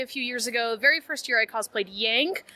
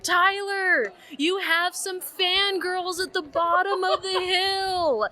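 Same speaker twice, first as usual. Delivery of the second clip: higher, slow mock yell up the hill; retold call up the hill; 7 semitones higher, slower than usual. Calling to friend up a hill, mild mock yell. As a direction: call out to a friend far away, half-laughing. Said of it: "And I scream up the hill".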